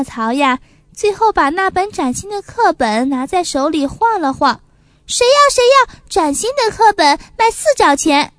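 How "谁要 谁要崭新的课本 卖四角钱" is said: calling out. Acted. Hawking his schoolbook to crowd.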